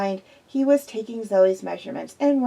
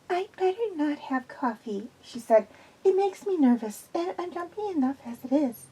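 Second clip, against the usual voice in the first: lightly raised, anxious woman's voice; nervous young woman; 6 semitones higher, 5 dB quieter than usual. A narrator's reading. Zoe tells Quillian she is nervous, lightly voiced anxious woman. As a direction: play Zoe uneasy and jumpy.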